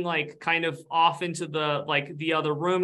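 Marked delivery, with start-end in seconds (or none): none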